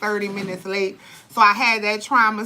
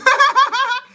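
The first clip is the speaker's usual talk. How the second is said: high loud laugh; real laugh; burst of laughter; 7 dB louder than usual. Real, not acted. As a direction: cackle at the memory. Laughs after baby flew out.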